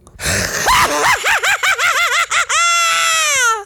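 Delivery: high shrieking witch cackle. Pretend. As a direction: let out a wild witch cackle. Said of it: "That is good".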